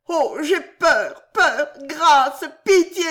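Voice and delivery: high, slow, sobbing-laughing plea; terrified pleading. A narrator's reading. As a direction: break down begging for mercy. Old man begs in terror.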